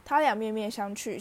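plain reading voice

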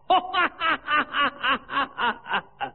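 high, falling mocking laugh. Queen laughs at Jiao Kui's ugly face, mocking laugh. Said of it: "你笑什么？".

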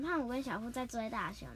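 ordinary talk

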